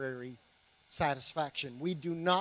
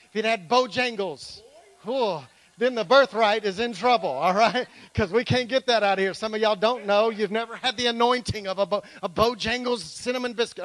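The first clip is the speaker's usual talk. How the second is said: louder, exultant joking preach; preaching at full force; 3 semitones higher, 10 dB louder than usual. Real, not acted. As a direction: whip the room up about the biscuit. Preacher's joke building up.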